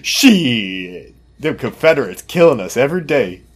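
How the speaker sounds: loud, hammed-up mock alarm in a put-on voice; mock panic. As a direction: parody her shock, overdone and laughing. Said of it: "and you thought".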